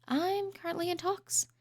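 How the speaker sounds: I'm currently in talks, with "I'm" lifted high and said softly. Shown as a demonstration. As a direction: toss it back up high and quiet. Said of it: "repeats line higher".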